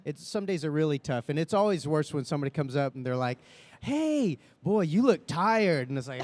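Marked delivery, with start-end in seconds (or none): 3.8-5.9 high, slow teasing fan imitation; nosy fan voice. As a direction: play the fan who says he looks tired. Imitating a fan greeting him.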